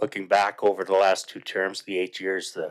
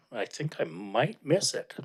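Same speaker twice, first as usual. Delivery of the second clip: composure breaking; 6 semitones higher, 4 dB quieter than usual. Real, not acted. Farewell speech, voice catching.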